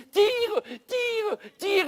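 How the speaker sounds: high, loud frantic yells; frantic shouting. Retold or imitated. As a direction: yell at him to pull, panicked. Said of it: "Il disait".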